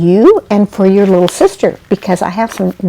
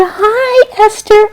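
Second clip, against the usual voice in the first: put-on sweet greeting to a baby; 13 semitones higher, slower than usual. A narrator's reading. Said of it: "Jeannie sat down and said".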